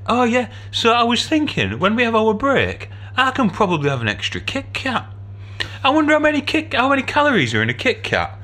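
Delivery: high, bright-voiced gossipy nurse; mimicking the standing nurse's chirpy gossip; chatty nurse. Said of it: "And she said".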